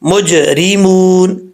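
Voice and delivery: مجرمون drawn out, ج echoing back; slow مجرمون with bouncing ج; qalqala demo. Shown as a demonstration. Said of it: "یہ آواز لوٹے گی تو اس کا مطلب یہ قلقلہ ہو رہا ہے". (مجرمون میں ج کی لوٹتی آواز)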